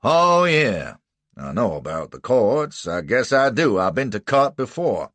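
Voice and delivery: loud sneering rough retort; scornful slave catcher. A narrator's reading. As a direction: sneer at the lawyer. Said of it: "grunted and sneered at him".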